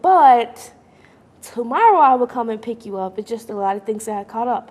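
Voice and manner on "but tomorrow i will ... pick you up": mentor booming the promise of tomorrow; louder cheerful promise. Retold or imitated. Mentor on the phone promising to come tomorrow, loud and bright. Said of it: "And she's like".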